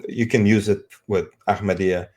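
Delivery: ordinary talk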